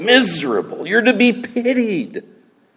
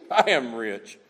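low, soft, self-satisfied; smug bragging; 9 semitones lower, 8 dB quieter than usual. Retold or imitated. Preacher voicing the church, low smug murmur. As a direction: savour it quietly to yourself. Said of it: "They were bragging about this".

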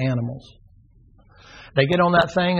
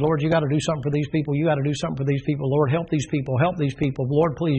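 rattled off quick and breathless; fast-talking plea; faster than usual. Acted. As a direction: rush the prayer like a Bostonian. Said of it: "the way he was talking so fast".

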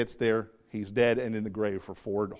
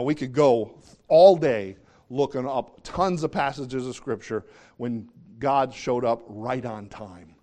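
ordinary delivery

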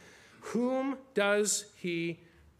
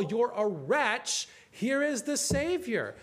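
preacher's gospel call; 6 semitones higher, slower than usual. Retold or imitated. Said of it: "He has this gospel call".

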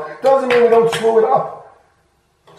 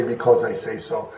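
low, soft, tired brush-off; weary parent; 10 semitones lower, 8 dB quieter than usual. Acted. Parent at the end of a long day, weary brush-off. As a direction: drop it low and quiet, like a worn-out parent. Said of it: "It is easier to just say".

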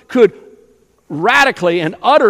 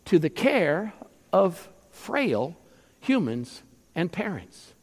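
softer, slow, dropping almost to a hush; hushed awe; 10 dB quieter than usual. Acted. Preacher marvels at God in frail human care, hushed. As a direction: let it fall away in wonder.

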